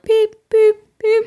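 high-pitched electronic beeping; short, high mock beeps; imitated machine beeps. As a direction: mimic a high medical machine beep. Imitating operating room machine sounds.

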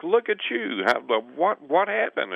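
high, amazed exclamation; amazed bus driver. Retold or imitated. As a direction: gush at him like he's a miracle. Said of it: "And everybody's like".

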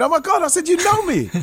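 very high squeal of amazement; squealing fanboy, acted out; retold starstruck excitement. Acts out starstruck fan reaction.

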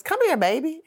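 put-on high, motherly call of an elderly lady; old lady calling. Old prison minister calls the inmate over, high and coaxing. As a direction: coax her over like a sweet old churchgoer. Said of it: "she looks at me and she says".

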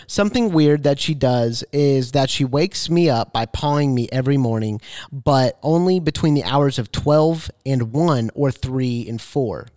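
usual speaking voice

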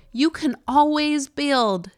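slow drawled Utah vowels; Utah accent. Shown as a demonstration. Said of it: "I'm gonna say that again without my Utah accent".

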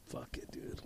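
breathy unvoiced whisper. Recalling the moment he stopped folding shirts, whispered. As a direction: drop to a secret whisper, as if confessing.

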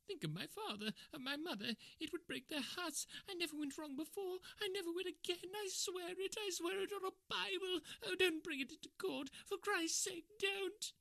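desperate begging. A narrator's reading. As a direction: beg for your life, near tears. Ryder begging, far higher voice.

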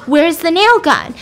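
high, loud acted demand; getting mad. Darby presses Jay for the nail gun, getting mad. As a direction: raise your voice in an acted angry question. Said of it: "she's getting mad".

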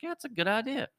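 high-pitched, eager yes; eager agreement. Retold or imitated. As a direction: chirp it up like a thrilled kid. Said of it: "I was like".

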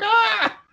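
high shriek; toddler scream. Retold or imitated. Roger screaming at the masked man, high shriek. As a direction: shriek in fright. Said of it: "He's like".

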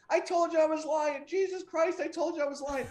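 high-pitched mock panic; hammed-up desperate confession; panicked pleading. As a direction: plead high and urgent, as a caught liar. Retold man begging, held by ear.

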